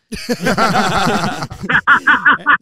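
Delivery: loud burst of group laughter; big, high, loud laugh. Real, not acted. Laughing at own boxing punchline.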